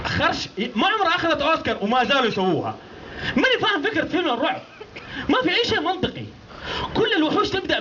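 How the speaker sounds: high laughing exasperation; escalating comedy rant. Acted. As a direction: rant while cracking up. Escalating rant on horror films.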